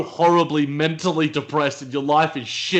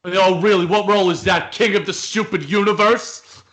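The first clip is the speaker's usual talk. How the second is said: raised, incredulous sarcasm; acted sarcastic outrage; 5 semitones higher, 4 dB louder than usual. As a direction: build to a high, mocking question. Acted scene, Michael mocks the news.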